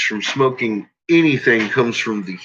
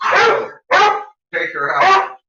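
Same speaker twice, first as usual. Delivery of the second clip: much higher, louder rising urging; excited pet talk; 4 dB louder, much slower than usual. Acted. Owner egging his dog on in a much higher voice. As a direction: egg the dog on way up high.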